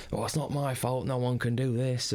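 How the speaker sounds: self-mocking London accent. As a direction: brush off the blame in London accent. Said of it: "excuse my terrible London accent".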